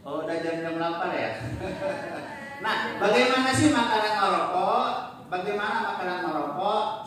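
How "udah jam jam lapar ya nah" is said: ordinary talk, nothing marked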